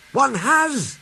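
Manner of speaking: Donald bursting out. A narrator's reading. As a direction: blurt it eagerly. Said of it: "burst out Donald".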